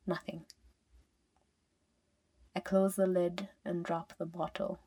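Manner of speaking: plain reading voice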